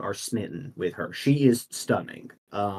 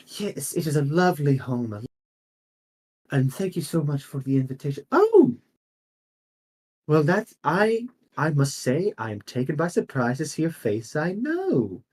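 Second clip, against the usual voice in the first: lilting, much higher noblewoman at a party; high lady voice; 4 semitones higher than usual. Acted. Noble lady greets a familiar face at the party, high and surprised. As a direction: play the gracious hostess, pitched way up.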